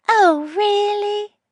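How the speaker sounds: put-on high, slow syrupy old lady; mockingly sweet grandma. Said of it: "the syrup back in her voice".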